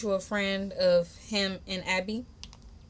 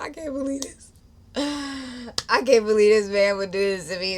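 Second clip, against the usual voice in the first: high, tearful disbelief, acted out; sighing, half-sobbed retelling; mock crying; 3 semitones higher, 6 dB louder than usual. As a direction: imitate crying as you complain. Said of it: "I'm, like, crying at the same time".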